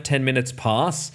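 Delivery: ordinary talk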